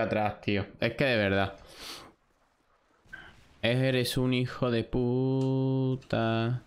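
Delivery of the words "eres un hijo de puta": long, drawn-out words on a held note; slow frustrated curse. Real, not acted. Player cursing whoever killed him in the game, slow drawn-out frustration. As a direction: stretch the insult right out, very slowly.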